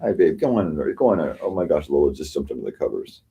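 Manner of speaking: ordinary talk, nothing marked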